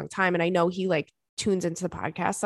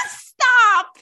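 laughing squeal of stop, much higher and louder; 9 dB louder than usual. Real, not acted. Laughing at her own Caterpillar joke, shrieking stop.

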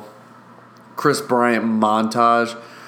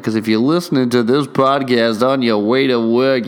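ordinary delivery

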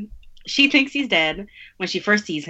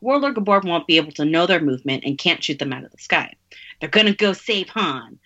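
normal talking voice

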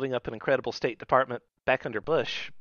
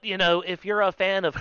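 ordinary talk, nothing marked

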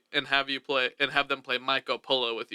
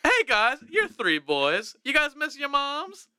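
cheesy ad pitch; 9 semitones higher, slower than usual. Acted. Hammed-up ad pitch to cohosts.

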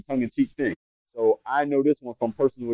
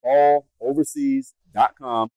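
balloverseas dot com drawn out at half speed and louder; slow, emphatic repeat; 4 dB louder, much slower than usual. Shown as a demonstration. Host repeating the website for viewers, slow and emphatic. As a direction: spell out balloverseas dot com slowly so it sticks. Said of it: "Yes, you heard me right".

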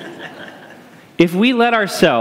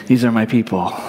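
fond aside; 9 semitones lower than usual. Retold or imitated. Speaker's own fond reaction.

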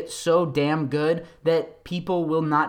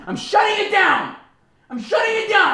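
much higher, louder comic yell; mock shout; 5 dB louder than usual. Real, not acted. Shouting to shut down his own political rant. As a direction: bellow it to cut yourself off.

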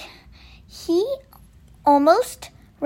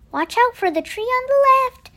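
high, slightly louder lookout's alert; urgent warning; 8 semitones higher, 4 dB louder than usual. A narrator's reading. Kevin guiding Percy round a tree, urgent high warning. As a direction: call out a warning, high-pitched.

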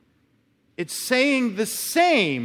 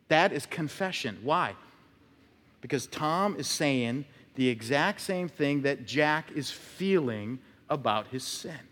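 normal talking voice

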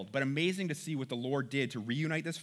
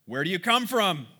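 rough, louder and higher demand; 4 semitones higher, 5 dB louder than usual. Acted. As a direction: question them roughly, like strangers. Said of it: "spoke roughly to them".

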